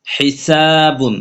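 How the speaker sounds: হিসাবুন with সা drawn out long; slow recited হিসাবুন; elongated madd. Shown as a demonstration. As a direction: stretch the সা in হিসাবুন. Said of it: "সিন আলিফ জবর সা".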